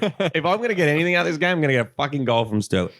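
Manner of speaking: fast, rising-pitch plea; retold fan rant. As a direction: rattle it out like a desperate fan. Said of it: "I was fucking like".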